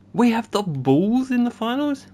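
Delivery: a high, loud cry of disbelief; can't believe what he sees on screen; stunned disbelief. Real, not acted. Gamer shocked by the sim result, high loud disbelief.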